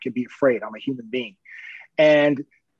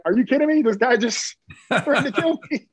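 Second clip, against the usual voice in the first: much higher, disbelieving, laughing through it; incredulous outburst; 11 semitones higher than usual. Retold or imitated. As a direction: squeak out disbelief at the absurd request. Reacting to being told to pray.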